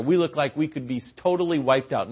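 ordinary talk, nothing marked

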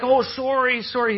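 put-on high, hurried old-man sorry; old man apologizing to the crowd; flustered apology. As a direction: play him panicked and apologetic. Old man apologizing amid spraying pop.

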